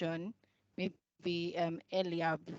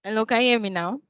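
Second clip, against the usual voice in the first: louder, clearer question; speaking up; 4 semitones higher, 10 dB louder, much faster than usual. Real, not acted. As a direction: project the voice to be heard. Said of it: "Much better".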